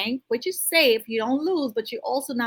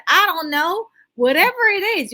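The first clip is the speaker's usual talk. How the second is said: excited burst; 7 semitones higher, 6 dB louder than usual. Real, not acted. Sudden loud high aside.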